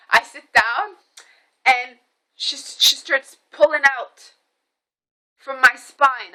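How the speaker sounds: ordinary talk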